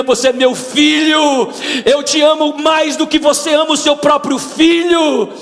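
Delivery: high, forceful voice building to a cry; God's impassioned command. Retold or imitated. God telling his son to stop destroying himself, impassioned and loud. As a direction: declare fierce fatherly love at full force. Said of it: "Deus olhou pra mim e disse".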